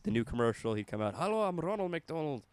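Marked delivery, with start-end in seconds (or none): 1.1-2.4 lilting, higher-pitched mock Scottish pitchman; Scottish Ronald McDonald. Clown pitching his new shake in a commercial, Scottish burr. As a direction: put on a hammed-up Scottish accent. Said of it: "make Ronald McDonald Scottish, go all out".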